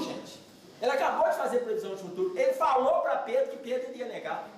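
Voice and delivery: ordinary delivery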